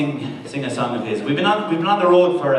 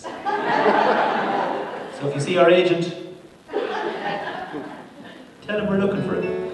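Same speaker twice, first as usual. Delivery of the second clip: laughing; 5 semitones higher, much slower than usual. Real, not acted. Joking threat about agent.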